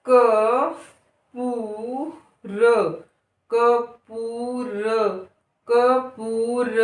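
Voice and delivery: अनूप and कपूर with the ऊ held; slow drawn words. Shown as a demonstration. Teacher reading words slowly to children. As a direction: stretch the long ऊ. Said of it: "समय लगेगा".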